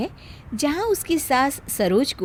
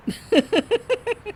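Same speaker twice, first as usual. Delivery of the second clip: high gloating villain chuckle; scheming mother-in-law laughing at the bahu; sly laugh; 10 semitones higher than usual. Acted. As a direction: laugh as if you have won. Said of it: "देखकर हंसती है".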